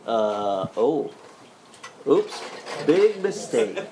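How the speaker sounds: laughing "oops", caught out; sheepish laugh. Retold or imitated. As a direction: chuckle at your own blunder. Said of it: "And he goes".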